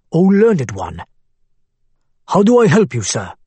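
put-on Arabic accent. A narrator's reading. Abu Hamad's voice.

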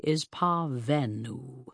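"parvenu" said slowly, each syllable crisp; slow, exact, disdainful "parvenu"; precise articulation. Acted. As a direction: pronounce "parvenu" with cold precision. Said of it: "He articulated the last word precisely".

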